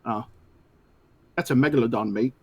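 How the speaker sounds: low-voiced, botched British tough-guy impression; badly faked British accent, pitched low; Jason Statham. Co-host attempts a movie line on a listener's request, botched celebrity impression. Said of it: "in Jason Statham's voice".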